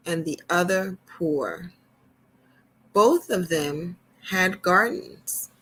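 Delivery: gentle storyteller reading to little ones; storybook reading. Acted. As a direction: read it like a picture book to kids. Reads storybook to children.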